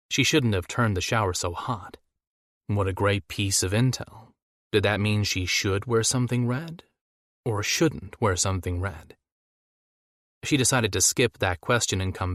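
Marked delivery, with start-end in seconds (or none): none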